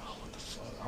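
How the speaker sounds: retold under-the-breath disbelief; stunned whisper. Friend recalling his shock at Kobe news, stunned whisper. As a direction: whisper it, barely any voice, in shock.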